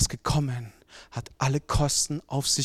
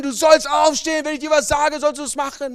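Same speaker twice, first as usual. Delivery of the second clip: loud, high yelled demand; screaming father; 12 semitones higher, 10 dB louder than usual. Acted. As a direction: shout the rule at the child. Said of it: "fängt an zu schreien".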